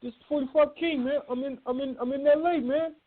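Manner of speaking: high-pitched put-on voice; high, squeaky-register caller greeting a friend; forty-five King. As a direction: mimic him way up high in pitch. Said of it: "he calls me".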